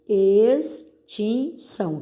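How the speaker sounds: "Extinção" slowly as ex, tin, ção; separando sílabas. Shown as a demonstration. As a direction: dictate "Extinção" with a pause after each syllable. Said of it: "separe as sílabas dessas palavras". ("Extinção" devagar, sílaba por sílaba)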